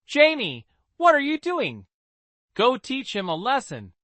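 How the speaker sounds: high, incredulous outburst; can't believe what she sees; shocked disbelief. Acted. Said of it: "couldn't believe it".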